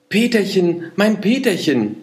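put-on high, bright child-star call; little star calling. A narrator's reading. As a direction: call out happily to Peterchen. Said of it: "rief das eine Sternchen".

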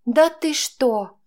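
slow, high mocking jeer; smirking. A narrator's reading. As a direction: sneer it through bloody lips. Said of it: "ухмыльнулась я".

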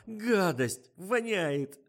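high, disgusted whimper; whining. A narrator's reading. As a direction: moan at the stink. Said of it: "ныла Фатима".

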